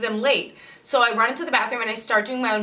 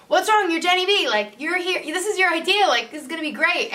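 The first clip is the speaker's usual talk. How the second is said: Jeff cheering her up; 8 semitones higher than usual. Retold or imitated. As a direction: pump her up, eager and bright. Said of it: "And Jeff's like".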